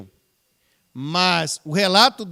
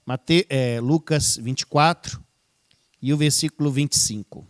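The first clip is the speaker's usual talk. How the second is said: ordinary talk, nothing marked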